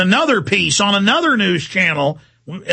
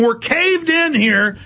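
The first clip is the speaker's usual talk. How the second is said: ordinary talk, nothing marked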